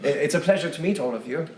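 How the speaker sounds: a fussy gentleman bowing hello; polite NPC voice. Acted. GM as Pipwin Potts greets the party, high polite voice.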